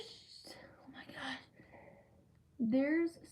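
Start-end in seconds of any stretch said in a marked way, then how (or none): 0.0-1.4 soft whispered aside in pain. Real, not acted. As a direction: drop to a pained whisper. Breaks off, pain from cut.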